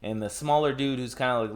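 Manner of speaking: usual speaking voice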